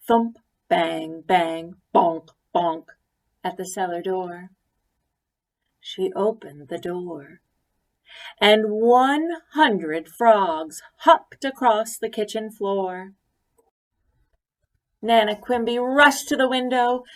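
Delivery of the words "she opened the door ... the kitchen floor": ordinary delivery, nothing marked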